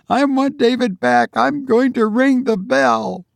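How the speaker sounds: high, fervent woman's cry; distraught mother past reasoning; desperate insistence. Acted. Fights free to ring bell.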